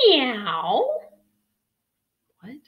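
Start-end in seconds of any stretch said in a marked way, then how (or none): 0.1-0.9 loud drawn-out meow; cat meow. Acted. George the pup answering his mother, loud cat meow. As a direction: mimic a cat with gusto. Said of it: "And George went".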